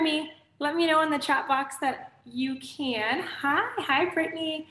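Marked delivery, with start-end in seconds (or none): none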